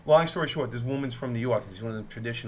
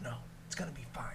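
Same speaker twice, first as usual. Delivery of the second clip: reassuring; 17 dB quieter, faster than usual. Retold or imitated. Calming panicked woman on plane.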